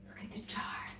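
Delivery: a sharp little gasp, then whispered. Acted. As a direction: gasp as if spotting something secret, then whisper it. Reading, sudden hushed discovery.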